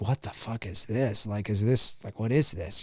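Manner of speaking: soft, low and puzzled; creeped out. Retold or imitated. Sam listening to the caller, quietly creeped out. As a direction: murmur it, unsettled. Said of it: "Sam is like".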